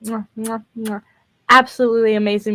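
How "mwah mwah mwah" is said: soft kissing smacks for praise; chef's kiss sounds. Real, not acted. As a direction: blow kisses to the music. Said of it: "her music is".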